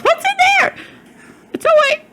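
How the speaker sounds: high, loud grandma voice; sweet curious grandma, pitched high; high old lady voice. Retold or imitated. Grandma quoted in story.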